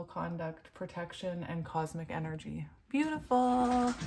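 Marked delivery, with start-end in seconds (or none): 2.9-4.1 delighted. Real, not acted. Admiring the card spread.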